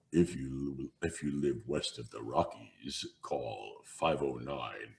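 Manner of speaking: deep, slow radio announcer impression; Sam, deep rich voice. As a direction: drop low and draw it out like a rich-voiced station ID. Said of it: "that really deep, rich voice".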